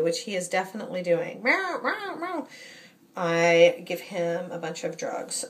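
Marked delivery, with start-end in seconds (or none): 1.4-2.5 high, whiny cat meows; cat meowing. Retold or imitated. Owner mimicking her needy cat's nagging, imitated meowing. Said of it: "Every time he starts meowing obsessively".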